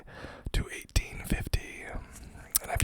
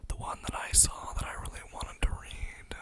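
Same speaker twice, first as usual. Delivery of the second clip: ordinary talk, nothing marked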